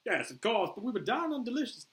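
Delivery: put-on high, loud faltering Australian accent; attempted Australian accent. Boomer explains himself to Flag, Australian accent. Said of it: "I can't do this accent".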